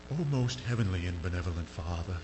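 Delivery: mock-holy voice, low and solemn; pompous praying pastor. Pastor reading his written prayer to God, mock-solemn. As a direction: imitate a stiffly reverent public prayer. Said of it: "he starts talking to God like".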